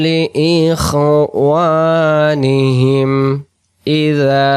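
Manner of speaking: high, slow melodic tilawat; Quran recitation. Acted. Teacher reciting the verse to the class. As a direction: sing the verse out slowly.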